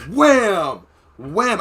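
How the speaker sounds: loud punchy wham sound effect; mock wham. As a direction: bang it out like a headbutt. Retelling drunk headbutting game.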